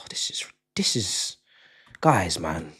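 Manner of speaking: breathless unvoiced whisper. Real, not acted. Streamer whispers, overwhelmed by fifty gifted memberships.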